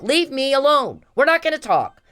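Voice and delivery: high, loud re-enacted scream; retold scream. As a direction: act out her screaming at him, pitch shooting up. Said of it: "She's screaming at him".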